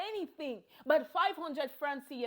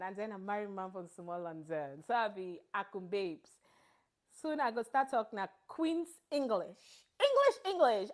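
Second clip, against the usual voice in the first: normal talking voice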